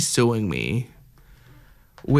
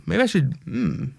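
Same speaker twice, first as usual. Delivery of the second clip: weird voice; 6 semitones higher than usual. Acted. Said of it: "that was a weird voice".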